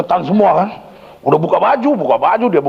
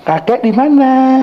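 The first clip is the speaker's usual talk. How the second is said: put-on high, slow wailing old-man voice; old grandfather spirit; 11 semitones higher, 4 dB louder, slower than usual. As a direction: whine helplessly as the banished spirit. Old spirit pleads after banishment. (suara kakek merengek, tinggi dan lambat)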